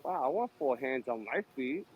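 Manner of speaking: ordinary talk, nothing marked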